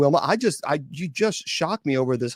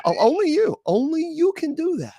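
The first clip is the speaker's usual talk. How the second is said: much higher, squeaky "only you"; squealing disbelief. Real, not acted. Cohost squealing in disbelief at Wilma's story.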